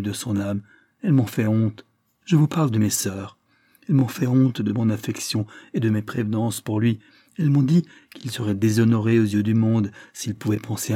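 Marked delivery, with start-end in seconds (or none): none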